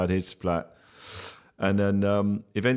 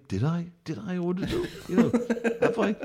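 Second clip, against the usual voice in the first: much higher, bewildered self-questioning with a laugh; confused inner doubt; 11 semitones higher than usual. Retold or imitated. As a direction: play the baffled host wondering if he ordered it. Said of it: "you could tell he's just thinking".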